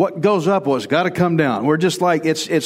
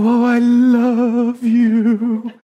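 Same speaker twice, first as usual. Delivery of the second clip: high, drawn-out lovey swoon; hammed-up sing-song "love", stretched long; mock gushing; 7 semitones higher, 3 dB louder, much slower than usual. Man mimicking what women want from guys, mock gushing. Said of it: "You want us to go".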